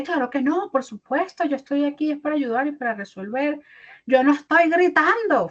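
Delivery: angry shout claiming not to shout; shouting denial. Retold or imitated. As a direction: yell that you're calm and here to help. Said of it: "Y uno lo dice todo gritado".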